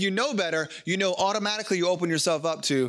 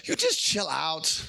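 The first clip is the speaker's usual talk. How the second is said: low, slow and soothing; calm God voice; 4 semitones lower, slower than usual. Retold or imitated. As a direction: settle the child down gently. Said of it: "And God says".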